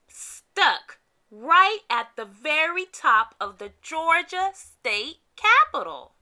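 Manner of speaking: plain reading voice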